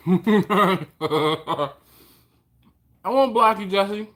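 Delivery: laughing, loud and high. Real, not acted. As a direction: laugh through the words. Laughing reply to viewer.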